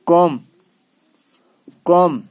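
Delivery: loud, separate model of कॉम with no B; silent B. Shown as a demonstration. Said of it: "ये बी silent है".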